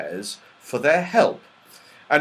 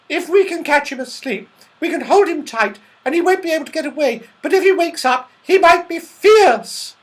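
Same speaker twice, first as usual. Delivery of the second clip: put-on high-pitched, louder girlish story voice; Goldilocks, eager and cautioning; 8 dB louder than usual. A narrator's reading. Goldilocks plans to catch the sleeper, high and urgent.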